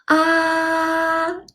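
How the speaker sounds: "ah" held high and level; high flat tone. Shown as a demonstration. Teacher showing first tone, "ah" high and level. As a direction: sustain "ah" on one high pitch. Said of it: "It's a high flat tone".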